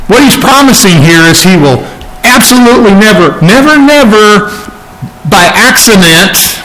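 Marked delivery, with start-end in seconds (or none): 2.2-4.5 every "never" high and insistent; emphatic promise. Real, not acted. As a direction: pound home each "never" with raised pitch. Preacher emphasizes God's promise.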